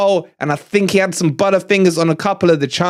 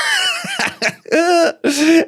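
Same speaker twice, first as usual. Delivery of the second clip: high laughing shout; 10 semitones higher than usual. Real, not acted. Fan cackling at rival's missed worldy shot. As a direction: burst of high whooping laughter.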